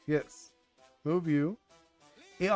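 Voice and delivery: usual speaking voice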